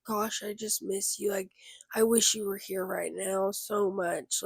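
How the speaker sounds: soft, subdued sadness; sad longing. Retold or imitated. Grieving woman to her lost best friend, softly sad. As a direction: quietly voice missing someone, sadly. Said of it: "then I'll get sad".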